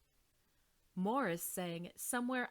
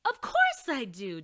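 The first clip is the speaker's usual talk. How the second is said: much louder indignant shout; 4 semitones higher, 10 dB louder than usual. Acted. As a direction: shout back in protest. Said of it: "Dolores shouted".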